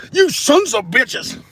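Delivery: high, loud, slow cursing yell; comic outburst. Pretend. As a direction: yell it in mock fury.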